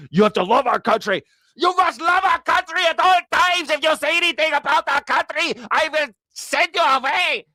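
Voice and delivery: high, shouted fanatic patriot voice; mock patriot. Mocking a flag-waving patriot.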